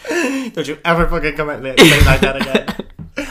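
mock threat. Acting out Kourtney's threat.

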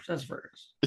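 whispered sassafras. Retold or imitated. Host imitating Jared for laughs, whispering. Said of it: "Jared's like".

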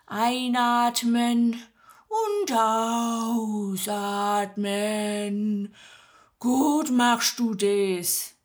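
slow, soothing Swabian midwife voice; breathing coach. Acted. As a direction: guide her breath, very slowly. Magda guides the breathing. (Magda leitet langsam das Atmen an)

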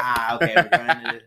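loud, flustered flight attendant; mock boarding announcement; cabin announcement. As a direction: call it out while checking where you're headed. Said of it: "getting on the microphone and be like".

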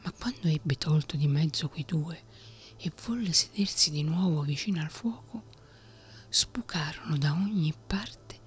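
plain reading voice

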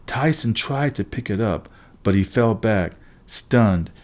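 plain reading voice

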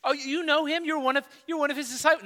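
imitated little girl, high-pitched and quick. Little girl questioning Peter about Jesus, high girlish imitation. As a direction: ask it high, like a curious girl. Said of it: "it was the little girl that asked him".

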